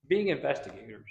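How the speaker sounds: usual speaking voice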